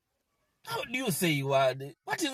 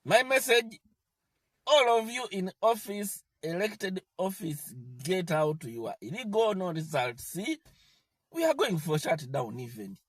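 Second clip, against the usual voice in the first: ordinary delivery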